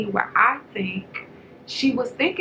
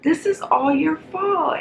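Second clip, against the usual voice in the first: Nikki blaming; 7 semitones higher, 3 dB louder than usual. Retold or imitated. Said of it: "She was like".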